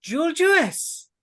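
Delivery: very high, loud stage introduction; Lady Sletney. Acted. Hostess announcing the bard's name, piercing cry. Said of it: "the piercing voice of Lady Sletney".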